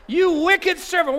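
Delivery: hammed-up outraged master, with a laugh; furious king. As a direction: shout down the wicked servant. King condemns the servant.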